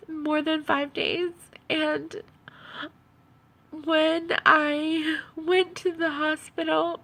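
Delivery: usual speaking voice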